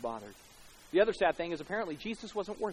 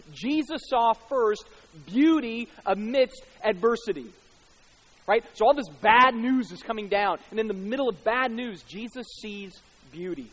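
high, loud, emphatic sermon delivery; loud pulpit proclamation; impassioned preaching; 7 semitones higher, 12 dB louder than usual. Real, not acted. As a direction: preach it out with rising force, leaning on key words. Preaches at full force.

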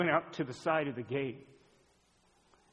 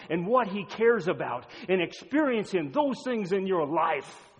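raised, emphatic pulpit voice; impassioned preaching; 5 semitones higher, 8 dB louder than usual. Acted. Preacher telling a congregation nothing beats God, impassioned. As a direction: preach it out forcefully, landing hard on key words.

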